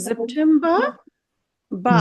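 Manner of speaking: সেপ্টেম্বর ending in an open "ba"; dropped final R. Shown as a demonstration. Teacher tells students to stop before the R, German pronunciation. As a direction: stop সেপ্টেম্বর on ba, leaving the R unsaid. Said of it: "বলে থামবেন".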